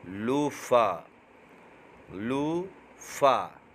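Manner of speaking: लूफा stretched very slowly, loo-oo-faa; drawn-out model word. Shown as a demonstration. A teacher slowly drilling the word लूफा for beginners to copy. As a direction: draw out लूफा syllable by syllable. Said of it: "घिया तोरी को क्या पढ़ेंगे?".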